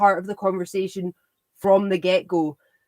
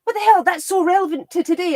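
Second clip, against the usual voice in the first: high, louder astonished cry; mock amazement; 9 semitones higher, 4 dB louder than usual. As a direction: act stunned at the discovery. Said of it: "And people go".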